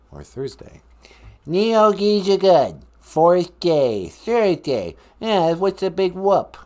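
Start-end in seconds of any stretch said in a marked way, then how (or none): 1.5-6.6 loud, slow mocking Boston echo; Boston accent. Columbus mockingly repeating host's lesson, put-on Boston accent. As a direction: parrot it loudly in fake Boston. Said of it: "talk with my Boston accent".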